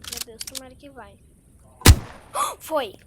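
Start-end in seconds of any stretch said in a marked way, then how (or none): 1.2-2.5 high sudden gasp of suspense; sharp gasp. Real, not acted. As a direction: gasp as the risky move lands. Tense moment, then success.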